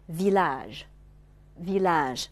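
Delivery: "village" with the a before the final ge drawn long; long final vowel. Shown as a demonstration. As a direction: slowly model "village", lengthening its last syllable. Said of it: "前面的元音读长音". (village末音节元音读长音)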